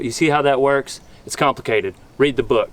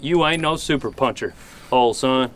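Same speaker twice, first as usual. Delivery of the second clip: ordinary talk, nothing marked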